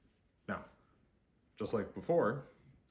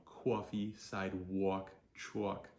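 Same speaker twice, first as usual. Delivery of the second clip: rounded New Jersey AW with a W glide; New Jersey accent; slower than usual. Shown as a demonstration. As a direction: lean into New Jersey vowels, word by word. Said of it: "produce a New Jersey accent".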